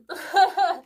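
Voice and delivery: loud, high burst of laughter; real delighted laugh. Real, not acted. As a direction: laugh out at the coincidence. Shirt coincidence.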